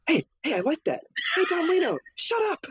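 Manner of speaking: a pufferfish voice cracking with glee; gleeful Sykes. Retold or imitated. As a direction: crow it out, tickled by your own nerve. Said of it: "And Sykes is like".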